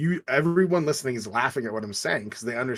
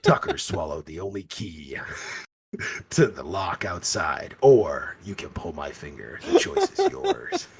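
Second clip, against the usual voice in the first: mock villain; 6 semitones lower than usual. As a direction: lay out the choice like a horror villain. Continuing villain parody.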